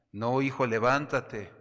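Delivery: loud firm command; a divine voice ordering the son up; God insisting. Acted. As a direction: insist loudly that he get up.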